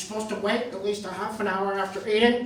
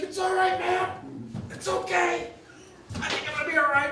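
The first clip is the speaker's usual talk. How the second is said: dazed cyclist reassuring; 10 semitones higher, 4 dB quieter than usual. Retold or imitated.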